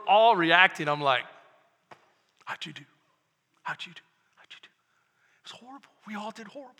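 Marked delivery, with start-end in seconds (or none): 2.4-4.7 How'd you do, asked in an ever-fading hush; nervous murmur to classmates, dying away; hushed anxious asking. Retold or imitated. Student asking classmates about a failed test, fading hush. 4.7-6.7 whispered. Retold or imitated. Pastor recalling the failed test to the church, whispered.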